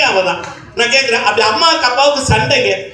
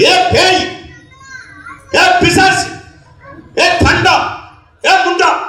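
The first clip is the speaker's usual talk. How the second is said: mock quarrel insults; 7 semitones higher, slower than usual. Husband yelling at his wife, mock angry. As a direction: hurl the insults like a fighting husband.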